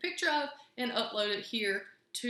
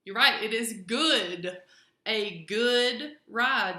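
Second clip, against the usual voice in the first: praising the class; 9 dB louder, slower than usual. Acted. Praising pupil's unseen answer.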